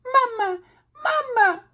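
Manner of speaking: very high tiny peep; peeping gosling. A narrator's reading. Gosling calling the robot mama, very high peep. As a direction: peep it like a chick calling mother. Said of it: "peeped the gosling".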